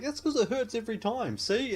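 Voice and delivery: high, loud whining retort; whiny retort. Retold or imitated. Wounded man to Blondie, high whining retort. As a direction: whine it back at her. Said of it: "he's like".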